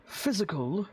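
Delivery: lightly voiced stiff butler; a hint of a proper old servant; formal butler. Butler Rayburn answers.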